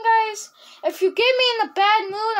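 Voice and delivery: ordinary talk, nothing marked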